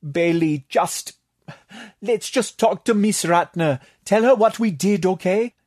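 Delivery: high, urgent plea; begging, pitched well up; pleading. A narrator's reading. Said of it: "Tatok pleaded".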